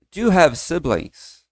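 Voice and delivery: slow, careful English question; slow quoted question. Retold or imitated. Interviewer asks the students, slowly. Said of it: "o entrevistador perguntou".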